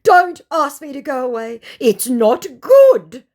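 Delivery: high, loud, slowing whine from the scammer; mock whiny plea. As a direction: protest like a hurt child, dragging the end. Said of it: "he goes".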